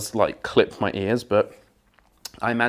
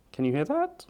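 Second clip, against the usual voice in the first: inside a helmet; 11 semitones higher, 4 dB quieter than usual. Real, not acted. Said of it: "I don't know if you can hear me in the, uh, in the helmet".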